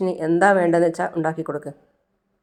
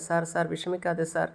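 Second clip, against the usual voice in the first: softly consoling; 8 dB quieter than usual. Acted. Maya consoling collapsing father.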